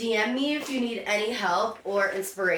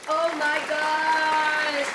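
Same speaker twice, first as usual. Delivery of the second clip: drawn-out, higher oh my gosh; thrilled; 6 semitones higher, 4 dB louder, much slower than usual. Real, not acted. Unboxer thrilled by a costume, excited. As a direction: stretch it out high in real excitement.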